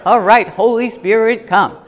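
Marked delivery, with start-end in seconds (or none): none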